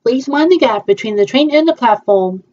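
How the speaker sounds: slow, even station announcement; train announcer. Pretend. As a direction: announce the stops like the Tube. Tube train announcement play.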